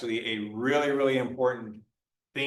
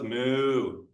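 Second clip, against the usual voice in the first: imitated cow moo; one long, level moo. Lecturer playing the cow answering the vet, mooing. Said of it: "And the cow goes".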